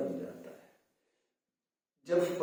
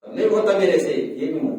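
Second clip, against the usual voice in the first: forceful complaint; 2 semitones higher, 8 dB louder than usual. Retold or imitated. Elders protesting they can't manage any more, loud and forceful. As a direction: imitate someone protesting at full strength. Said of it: "जितनी दम से आवाज में बोल रहे हो".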